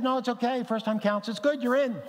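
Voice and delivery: high-pitched mock reassurance; retold comic concession. Preacher telling Kathy her first prayer counts, mock reassuring. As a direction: wave it off playfully, voice up high.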